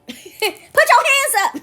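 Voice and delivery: high, slow pretend robber's order; mock stick-up shout. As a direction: draw it out high like a hammed-up robber. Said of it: "he was screaming so loud".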